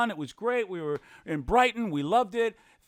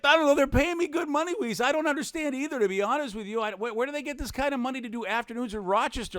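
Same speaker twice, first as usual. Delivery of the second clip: excited retelling; 7 semitones higher, faster than usual. Retold or imitated. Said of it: "I'm like".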